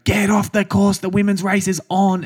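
high-pitched mock official yell; mock scream. Official ordering runners off the course, mock scream. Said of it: "screaming at me and Smack".

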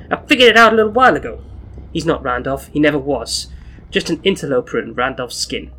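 brash, quick Brooklyn street voice; Brooklyn accent. Acted. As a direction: rattle it off like a Brooklyn tough. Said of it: "I should be doing this in the, my Brooklyn accent".

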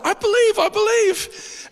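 high, slow mocking squeak; sarcastic high-pitched imitation; mock believer.

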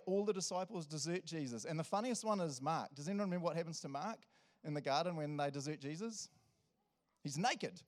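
usual speaking voice

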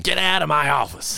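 gruff angry boss bark. Retold or imitated. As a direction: snap it like a furious boss. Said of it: "artist impression of Matt Groening".

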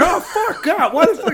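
high, loud, laughing outcry; mock shocked yelp. Moses crying out at God over his leprosy. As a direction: yelp at God in hammed-up horror.

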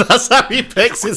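high, laughing through the words. Real, not acted. Co-host jokes about an actor's accent choice, laughing. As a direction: say it while cracking up.

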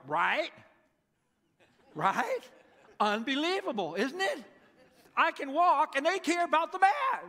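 laughing, incredulous and thrilled; excited disbelief. Acted. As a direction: burst out, can't believe the absurdity. Healed man marvels with Jesus.

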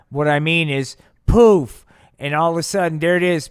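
loud, high, building to a shout on poof; excited reveal. Acted. [name] revealing the aliens to [name], loud excited reveal. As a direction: blurt it out like a magic trick.

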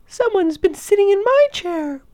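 tiny little voice, very high; squeaky little cub, distressed; Baby Bear. A narrator's reading. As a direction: cry it as an upset bear cub. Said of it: "cried in his tiny little voice".

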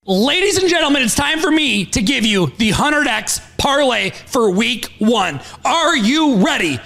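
loud hyped-up announcer read; hype announcer. Acted. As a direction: build excitement like a hype man.

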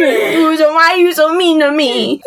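high mock-tearful whine; hammed-up sulky wail; childish whine. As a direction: plead like a wounded toddler. Continues mocking the childish tantrum.

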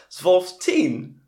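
high, loud hammed-up Dutch accent; bad Dutch accent. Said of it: "with a bad Dutch accent".

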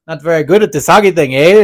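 a hint of a Canadian accent, loud and cheerful; sweet-toned Canadian insult; mock Canadian. Hockey player chirping an opponent in a mock Canadian accent. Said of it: "in such a nice tone".

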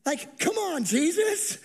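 high, incredulous whine at Jesus; sarcastic disbelief. Acted. Disciples whining at Jesus, high and sarcastic. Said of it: "respond with the same sarcasm".

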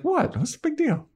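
very high, careless shrug of a voice; mock nonchalant boss. Podcaster mocking the boss's nonchalance, high careless shrug. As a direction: toss it off like it's nothing.

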